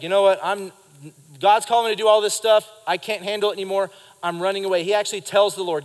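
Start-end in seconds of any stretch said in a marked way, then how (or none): 0.0-4.8 fast, louder, hurried and overwhelmed; Elijah's panicked outburst. Retold or imitated. Elijah telling God he's running away, loud and rushed. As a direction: blurt it out like a man at the end of his rope. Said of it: "He's like".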